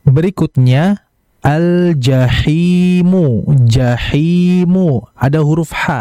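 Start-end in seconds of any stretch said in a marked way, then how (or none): 1.4-5.1 slow jahimu with a clear breathy h. Shown as a demonstration. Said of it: "hati-hati ketika membaca huruf H".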